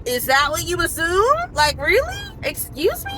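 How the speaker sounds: high, rising incredulous questions; retold indignant double-take; offended disbelief. Retells being offended by his assumption.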